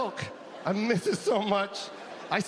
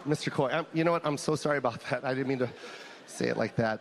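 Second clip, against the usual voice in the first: sheepish doctor; 9 semitones lower than usual. Retold or imitated. Doctor apologizing for the buttocks remark, low and sheepish. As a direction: mumble an awkward apology. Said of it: "Oh my God".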